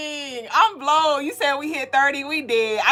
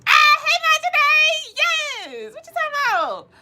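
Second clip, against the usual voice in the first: excited greeting; slower than usual. Real, not acted.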